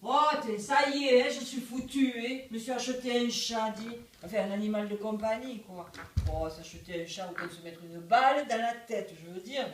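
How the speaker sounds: exasperated storyteller with emphatic outbursts; monologue comique. Shown as a demonstration. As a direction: demonstrate the woe with lively, punchy openings. Coach demonstrates the monologue. (récit comique exaspéré)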